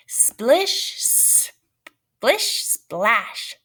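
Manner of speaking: sing-song splish splash; rising playful sound words; playful sound effect. Acted.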